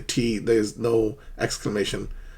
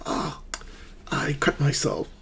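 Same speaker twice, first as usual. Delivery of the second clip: whispered oh, softer, like a small cut; pained whisper; 3 semitones higher, 6 dB quieter than usual. Shown as a demonstration. Teacher acting out cutting a finger, whispered pain. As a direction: hush it out, wincing. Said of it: "like when you hurt yourself".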